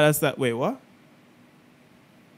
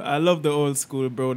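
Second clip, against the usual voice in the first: ordinary delivery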